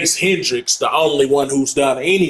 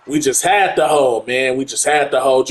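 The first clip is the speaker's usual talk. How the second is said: ordinary delivery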